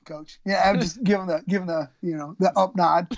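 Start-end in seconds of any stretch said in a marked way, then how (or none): 0.1-0.4 understated too-cool "Coach."; acting casual in front of his hero; playing it cool. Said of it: "I was like".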